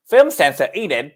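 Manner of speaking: hammed-up British accent, falling on the name. Shown as a demonstration. Co-host reading the movie blurb on request, attempted British accent. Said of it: "grace us with your British accent".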